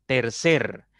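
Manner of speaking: «Tercer» con la última sílaba fuerte; acento en «cer». Shown as a demonstration. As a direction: marca bien el «cer» de «Tercer». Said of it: "la que suena más fuerte". (acento fuerte al final de «Tercer»)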